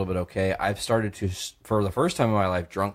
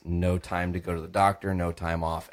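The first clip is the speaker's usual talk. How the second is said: ordinary talk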